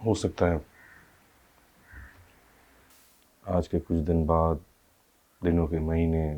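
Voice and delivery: hushed, heavy-hearted recollection; subdued grief. Acted. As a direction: let the voice sink quietly with sorrow. Telling of the child's death.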